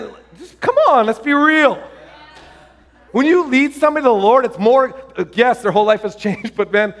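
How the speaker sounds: ordinary delivery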